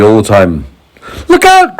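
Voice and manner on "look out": high, loud cry; shouting a warning. A narrator's reading. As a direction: warn everyone urgently. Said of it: "cried James".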